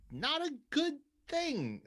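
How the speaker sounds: high, slow, incredulous emphasis; high emphatic drawl. Real, not acted. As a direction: stretch each word out high. Exasperated retelling of hospital visit.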